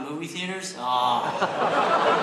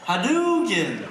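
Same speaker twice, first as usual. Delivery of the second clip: video game yell; 4 semitones higher, 5 dB louder, much slower than usual. Retold or imitated. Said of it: "yells straight in my face".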